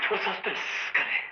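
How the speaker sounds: slow hushed whisper to build suspense; spooky whisper. Acted. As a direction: lean in and whisper the teaser. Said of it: "சொன்னா பயப்பட மாட்டீங்களே". (மர்மமான கிசுகிசுப்பு)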